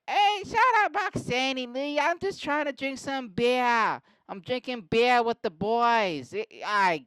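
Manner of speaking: put-on high-pitched, hollered Boston accent; mock Boston yelling. Husband snapping back at his wife, high mock Boston yell. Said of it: "No one talks like this".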